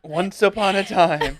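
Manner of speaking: slow fairy tale narrator; storybook narrator voice. Pretend. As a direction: read it like a storybook opening. Mimicking Shrek storybook opening.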